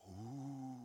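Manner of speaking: soft, breathy awed gasp of a crowd; mock awed gasp. Crowd gasps in awe at the clever speaker, hushed. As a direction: imitate the audience's impressed gasp.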